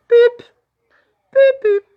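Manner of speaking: high, loud electronic-style beeps; short piercing vocal beeps; imitated beeps. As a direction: beep like a machine, loud and high. Imitates beeps while telling story.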